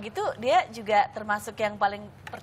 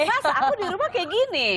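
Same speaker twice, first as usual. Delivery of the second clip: mock sweet wife; 9 semitones higher than usual. Mimics herself pleading sweetly.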